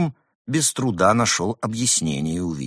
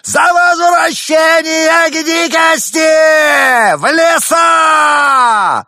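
very high, slow shrieking cry; 11 dB louder, much slower than usual. Acted. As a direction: break into a screech. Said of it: "срывается на визг".

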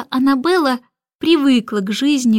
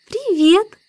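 put-on high-pitched little child's greeting; baby girl voice; 10 semitones higher than usual. A narrator's reading. Baby greets the returning starling, high childlike voice. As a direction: pipe up like a tiny girl.

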